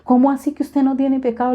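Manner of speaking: a son who can't believe what his mother just said; alarmed son. Retold or imitated. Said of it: "mi hijo nomás me miraba aterrado".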